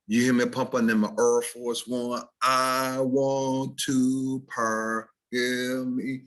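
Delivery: St. Louis rap accent with heavy Rs; drawn-out, half-sung St. Louis dialect. Shown as a demonstration. Teacher imitating a St. Louis rapper, St. Louis accent. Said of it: "or [name], like, uh".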